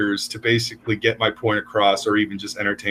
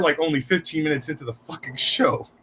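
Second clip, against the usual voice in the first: ordinary delivery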